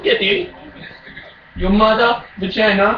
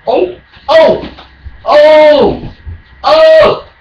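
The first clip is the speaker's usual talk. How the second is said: raging gamer; 6 semitones higher, 5 dB louder, much slower than usual. Real, not acted. Reacting loudly to the game.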